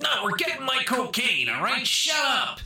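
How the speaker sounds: high hammed-up yell; cartoon villain yelling; mock gangster shout. Retelling the movie, Ray Liotta's high mock shout.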